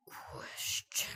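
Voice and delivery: drawn-out whisper. Pretend. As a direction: breathe the word out. Whispered echo.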